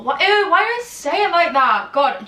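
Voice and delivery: loud, high-pitched self-disgust; real cringing outburst. Real, not acted. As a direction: recoil loudly at your own words. Cringes at her own pronunciation.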